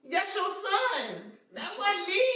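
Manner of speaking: high, slow, emphatic denial; drawn-out, high-pitched disclaimer; acted protest. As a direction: shift the blame with mock indignation. Acted protest to his mom.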